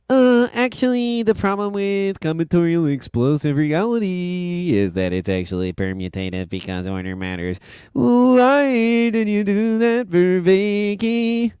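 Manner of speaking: silly nerd voice. Retold or imitated. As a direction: lecture it like a pompous nerd. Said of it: "That's how I read it in my mind".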